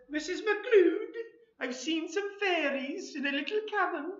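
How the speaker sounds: high-pitched voice of a husband reporting a wonder; excited storybook husband. Acted. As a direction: play the comic husband, pitched well up. Voicing husband in comic story.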